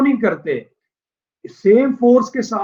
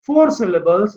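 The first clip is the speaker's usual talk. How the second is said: ordinary talk, nothing marked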